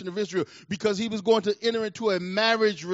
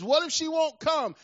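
anxious servant; 10 semitones higher, 3 dB louder than usual. Acted. Servant's worried question to Abraham.

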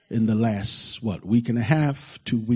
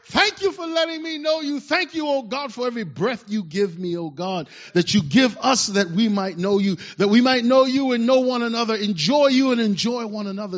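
much higher, fervent cry to God; worked-up prayer; 9 semitones higher than usual. Real, not acted. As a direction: pour it out, overwhelmed with gratitude. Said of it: "I get all worked up in my feelings".

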